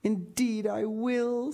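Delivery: slow, high, lightly tearful vow; sobbed promise. A narrator's reading. Diana promises Anne at parting, mildly sobbing. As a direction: draw it out with a hint of a sob. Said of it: "sobbed Diana".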